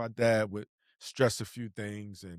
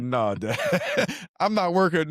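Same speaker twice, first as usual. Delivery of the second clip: laughing refusal; 4 semitones higher than usual. Retold or imitated.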